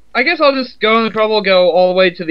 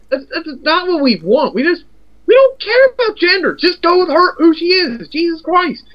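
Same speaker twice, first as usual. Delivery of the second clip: exasperated; 10 semitones higher than usual. Real, not acted. Exasperated rant about the character.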